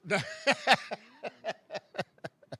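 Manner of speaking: laughing. Real, not acted. Laughs at own quip.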